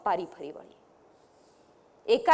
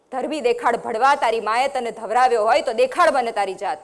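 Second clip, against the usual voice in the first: louder fierce taunt, ધરબી દેખાડ ભડવા rising; bone-chilling scream; 8 dB louder than usual. A narrator's reading. Woman mocking the man's manhood, loud scornful cry. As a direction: scream the insult in his face. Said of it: "લીલાએ હાડ થીજાવી દેવી રાડ પાડી".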